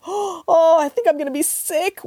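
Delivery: put-on high, queasy child's wail; Lucy wailing. A narrator's reading. Child sickened by the porridge story, wailing. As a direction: wail it high, about to be sick. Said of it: "wailed Lucy".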